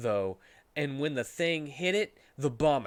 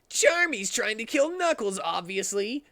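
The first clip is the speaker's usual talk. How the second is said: higher, louder matter-of-fact character voice; 8 semitones higher, 4 dB louder than usual. Acted. Sonic telling Amy, higher put-on voice. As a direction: state it like it's obvious, pitched up. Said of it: "said Sonic to Amy".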